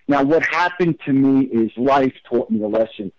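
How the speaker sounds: ordinary talk, nothing marked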